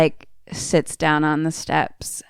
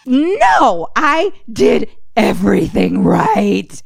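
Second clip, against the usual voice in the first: indignant protest; 3 semitones higher, 4 dB louder, slower than usual. Retold or imitated. Dad protests before exploding.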